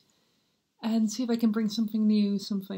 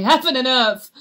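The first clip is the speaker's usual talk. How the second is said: loud, higher cry of outrage; anguished exclamation; 3 semitones higher, 10 dB louder than usual. Acted. Hamlet overcome by memory of his parents, loud anguished cry. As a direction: cry out to the skies.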